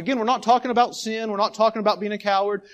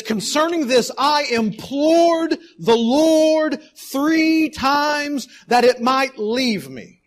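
slow pleading read; 5 semitones higher, 4 dB louder, much slower than usual. Acted. Rereads verse with emphasis.

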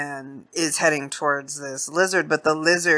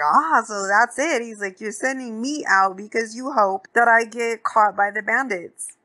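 higher-pitched, indignant realization; mock-indignant quote, pitched up; retold accusation; 7 semitones higher than usual. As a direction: imitate someone catching on to a scheme. Retold accusation in camp quarrel.

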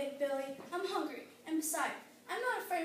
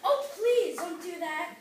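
louder, drawn-out plea; pleading; 8 dB louder than usual. Acted. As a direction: beg slowly, stretching the plea. Little goat begs the troll.

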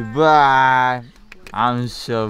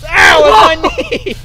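loud high yelp replayed for the camera; reenacted pain cry; 11 dB louder, slower than usual. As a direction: act out the hurt for viewers. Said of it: "what Sam did with the shovel".

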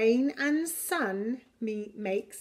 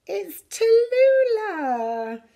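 high, loud and slow with joy; delighted greeting; 10 semitones higher, 7 dB louder, slower than usual. Acted. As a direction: greet her like a favourite friend. Greeting a new passenger.

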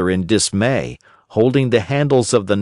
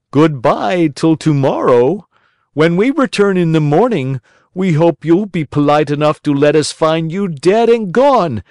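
higher, louder mocking call; mockingly; 6 semitones higher, 4 dB louder than usual. A narrator's reading. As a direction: taunt the hanging puppet, calling up to him. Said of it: "called to him mockingly".

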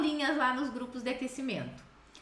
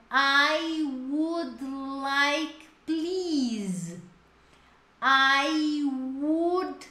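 "I would like, please" drawn out slowly; slow model phrase; much slower than usual. Shown as a demonstration. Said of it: "Então". ("I would like, please" bem devagar)

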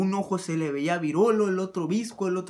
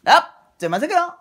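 high, very loud called-back answer; shouted reply; 10 semitones higher, 12 dB louder than usual. Acted. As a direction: yell it back from a distance. Acted reply while checking for cars.

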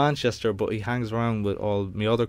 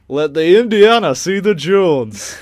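slow, loud northern English impression; hammed-up northern English accent; 5 semitones higher, 8 dB louder, slower than usual. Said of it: "sound like [name]".

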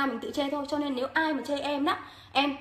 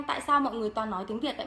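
ordinary talk, nothing marked

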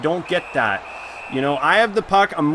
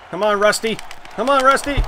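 urging building; 7 semitones higher, faster than usual. Real, not acted. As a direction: egg the player on, climbing. Urging player in final seconds.

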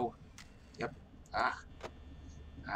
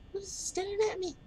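squeaky voice. Acted. Squeaky high mock voice.

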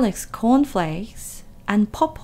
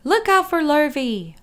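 higher, calling cow voice; 6 semitones higher, 4 dB louder than usual. A narrator's reading. Cows warning Wilbur, calling out high. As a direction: call out a warning. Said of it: "called the cows".

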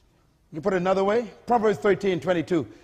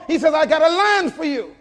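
high, loud pulpit proclamation; preacher at a raised, ringing pitch; full-force preaching; 8 semitones higher, 8 dB louder than usual. Real, not acted. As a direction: drive the refrain home to the congregation. Preacher builds to a climax.

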